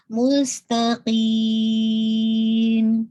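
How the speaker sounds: مستقیم very slow, س whistling, nasal only at the end; whistled sin, nasal end. Shown as a demonstration. Teacher showing the student a slow مستقیم. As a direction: stretch مستقیم, hiss the س, nasalise the final م. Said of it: "nasal sound کی طرف لے گئی ہوں".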